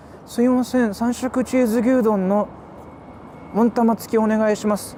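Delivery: rehearsing a cheese gyudon order; practicing the order aloud; practice order in Japanese. Shown as a demonstration. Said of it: "let me just practice".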